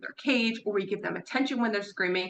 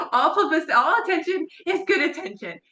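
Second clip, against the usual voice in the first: 1 laughing emphasis; 7 semitones higher, 4 dB louder than usual. Shown as a demonstration.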